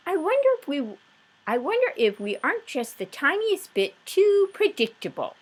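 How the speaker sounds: high, bright wife voice; voiced Mrs Watson. Wife musing to husband, high and light. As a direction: pitch up as the wondering wife.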